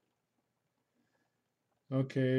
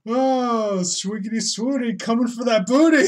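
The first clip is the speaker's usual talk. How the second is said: high, loud mock ghost taunt; hammed-up glee; 7 semitones higher, 8 dB louder than usual. Joking viewer voicing the ghost, gleefully hammy. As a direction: call it out in silly excitement.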